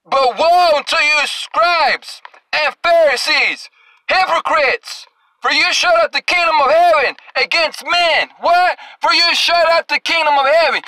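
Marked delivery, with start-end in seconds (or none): 0.0-8.3 preaching scripture. Acted. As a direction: thunder the verse at the crowd.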